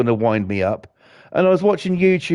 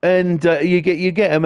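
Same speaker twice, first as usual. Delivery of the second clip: ordinary talk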